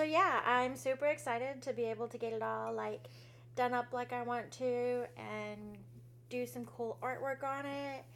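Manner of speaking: ordinary delivery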